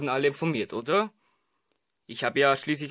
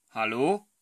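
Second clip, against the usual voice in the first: ordinary delivery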